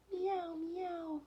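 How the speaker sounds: very high, soft kitten meows; cat meow. A narrator's reading. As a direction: mew like a frightened little cat. Cat meowing in story.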